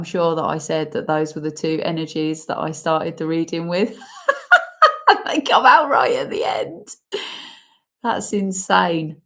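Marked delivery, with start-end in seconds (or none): 4.0-5.3 high laughing burst of disbelief; burst of high laughter. Real, not acted. Emotional reveal of final cards. 5.3-6.9 laughing. Real, not acted. Amazed cards came out last.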